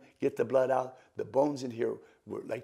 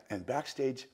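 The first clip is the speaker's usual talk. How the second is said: ordinary talk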